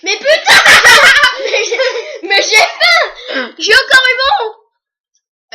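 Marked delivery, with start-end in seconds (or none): 0.3-1.4 high burst of laughter; loud laugh. Real, not acted. Wins again, bursts out. 1.4-5.5 slow, drawn-out laughing yell; laughing shout. Real, not acted. Wins quiz again, exasperated.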